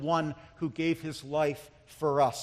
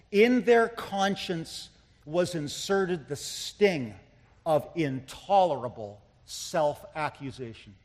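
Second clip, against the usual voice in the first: slow reading with pauses between phrases; slow, weighty quoting. Real, not acted. Preacher first quoting Joseph Parker to the congregation, slow and deliberate. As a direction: let each phrase sink in, slowly.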